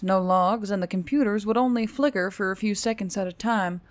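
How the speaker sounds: ordinary delivery, nothing marked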